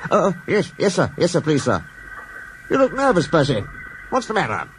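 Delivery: Percy stammering to the Fat Controller; nervous stammer. Acted. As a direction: stumble over words nervously.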